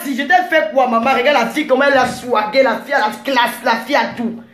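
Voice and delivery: ordinary delivery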